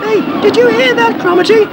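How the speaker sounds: very high lad's question; boy's voice. A narrator's reading. Ross asking Cromarty, very high and eager. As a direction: ask like an eager boy. Boy character asks.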